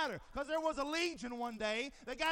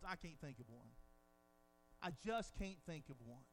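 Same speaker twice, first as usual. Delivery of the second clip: much softer and lower, reflective; hushed, awed aside; quiet; 10 semitones lower, 11 dB quieter than usual. Real, not acted. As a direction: say it almost to yourself. Dropped to near hush.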